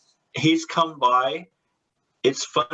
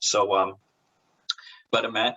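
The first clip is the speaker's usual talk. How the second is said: usual speaking voice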